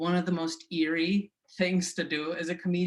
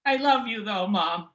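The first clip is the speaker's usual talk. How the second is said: higher, cheeky son backtalking; half-teasing the mother, higher; son answering mom; 5 semitones higher than usual. Retold or imitated. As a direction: son sassing his mom, then softening. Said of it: "like, um".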